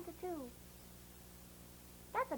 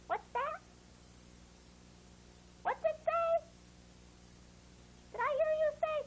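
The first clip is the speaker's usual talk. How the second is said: high, curious puppet voice; letters talking; 9 semitones higher than usual. Pretend. The letter buddies asking the class, high puppet voice. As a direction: lift it way up like a little cartoon letter. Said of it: "together they say".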